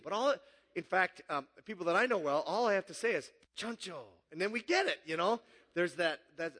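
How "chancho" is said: put-on softly spoken catchword in a Nacho Libre accent. Preacher cues friends with one movie word, Nacho Libre accent. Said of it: "that was a perfect accent".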